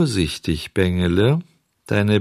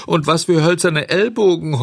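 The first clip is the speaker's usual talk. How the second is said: howling complaint; 8 semitones higher than usual. Acted. Schoolboy howling after a jab in the ribs. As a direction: whine about the sore ribs. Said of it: "heulte ein anderer".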